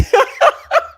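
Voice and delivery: high, loud peal of laughter; big laugh. Real, not acted. Streamer reacting to a happy dancing heart comment, laughing hard. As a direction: burst out laughing, real and loud.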